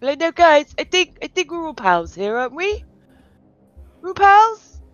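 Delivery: nervous appeasing. Acted. Player role-plays calming ogres.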